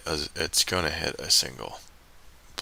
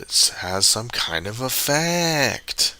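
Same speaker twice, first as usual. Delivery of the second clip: slow and weighty, higher, each word drawn out; slow, deliberate; 5 semitones higher, much slower than usual. Shown as a demonstration. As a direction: stretch it out slowly so every word lands. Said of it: "did you hear the way I said it?".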